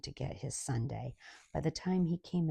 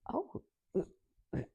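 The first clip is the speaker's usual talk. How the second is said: dim server voice; 3 semitones higher than usual. Retold or imitated. Server Debbie baffled by the order, high and halting. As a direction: play the clueless server, voice lifted high and unsure. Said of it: "she says".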